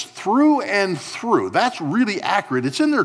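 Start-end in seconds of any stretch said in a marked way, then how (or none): 0.0-1.5 "through and through" drawn out very slowly and higher; slow emphatic phrase. Shown as a demonstration. Pastor pointing the congregation to "through and through", drawn out. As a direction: savor each word of "through and through". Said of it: "watch this".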